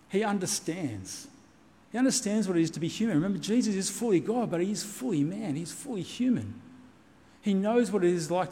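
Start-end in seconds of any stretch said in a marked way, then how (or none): none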